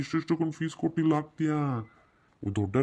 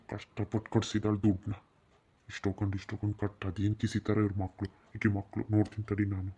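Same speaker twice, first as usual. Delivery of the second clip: soft, lower grumble under the breath; grumbling aside; 3 semitones lower, 6 dB quieter than usual. Acted. Husband grumbling softly to himself about the school fees. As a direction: grumble to yourself so the wife can't hear.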